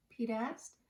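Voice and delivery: usual speaking voice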